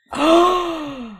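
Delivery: loud, high drawn-in gasp; dramatic gasp. Pretend. Storyteller reacting to the big reveal, shocked gasp. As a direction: gasp loudly at the twist.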